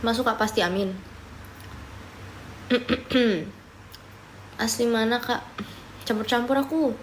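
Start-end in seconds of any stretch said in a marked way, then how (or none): none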